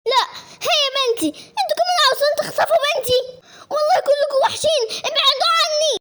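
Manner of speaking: high, tearful outcry; hysterical protest. Acted. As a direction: fight them off with a desperate cry. Distraught woman fights off everyone. (صراخ هستيري باكٍ)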